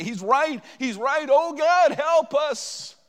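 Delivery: moved congregation crying out for help; weeping crowd cry. Retold or imitated. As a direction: cry out agreement, then plead. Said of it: "they begin to weep and say".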